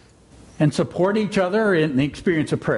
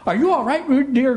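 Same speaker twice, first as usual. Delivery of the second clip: much higher, louder worried mother call; anxious call; 10 semitones higher, 3 dB louder than usual. A narrator's reading. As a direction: call out anxiously to her baby. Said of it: "called Kanga anxiously".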